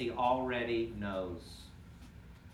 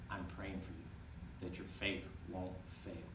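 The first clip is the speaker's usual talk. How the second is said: tender Jesus; 5 semitones lower, 10 dB quieter than usual. Pretend. Jesus looking at Peter with love, soft and tender. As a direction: speak it like a gentle promise.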